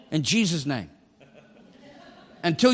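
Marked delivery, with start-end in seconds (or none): none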